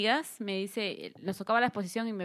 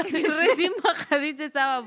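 loud, higher, slowed by laughter; laughing; 5 semitones higher, 6 dB louder, slower than usual. Real, not acted. Echoing friend's tease about being newly arrived, laughing. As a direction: laugh through the words.

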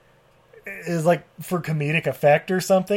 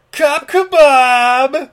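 high, loud, drawn-out shout; over-the-top catchphrase yell; 10 semitones higher, 9 dB louder, much slower than usual. Retold or imitated. Podcaster quotes the killer's wonderful phrase, over-the-top catchphrase yell. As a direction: bellow it slowly like a hammy movie one-liner. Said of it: "Said like it's fucking garbage day".

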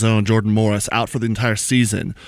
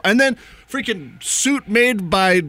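excited; 8 semitones higher, slower than usual. Real, not acted. Gushing over the goalkeeper's kit.

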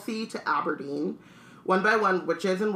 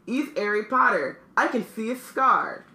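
higher, excited character voice; gleeful shout; 5 semitones higher, 4 dB louder than usual. A narrator's reading. Stan tells Ern it is Harry Potter, gleeful cry. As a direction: crow it to your mate. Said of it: "Stan shouted gleefully".

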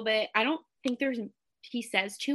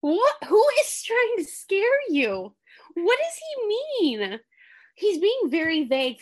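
baffled; 9 semitones higher, 4 dB louder than usual. Real, not acted. Baffled by the clip.

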